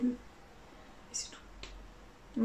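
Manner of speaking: a slow, drawn-out whisper. Real, not acted. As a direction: breathe it out slowly in a whisper. (chuchoté très lentement)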